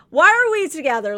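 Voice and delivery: high, loud, fast exasperated outburst; mock exasperation. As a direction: throw the question out in frustration. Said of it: "but he's like".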